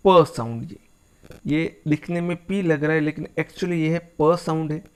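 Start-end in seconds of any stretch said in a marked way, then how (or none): 0.1-0.7 प said louder, lingered on as a model sound; demonstrating the p sound. Shown as a demonstration. Teacher showing students the first consonant, said louder. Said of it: "सबसे पहला sound हुआ अपना".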